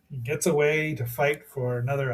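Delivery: ordinary talk, nothing marked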